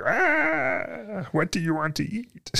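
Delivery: high, goofy Muppet voice; hammy Fozzie Bear impression. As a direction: chirp it like a silly puppet bear. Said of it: "Fozzie Bear thing that annoys the shit out of Hannah".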